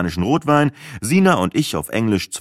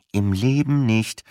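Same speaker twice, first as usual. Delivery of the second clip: pressed through a grin; 4 semitones higher, slower than usual. Acted. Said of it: "presste ich zwischen zwei grinsenden Backen hervor".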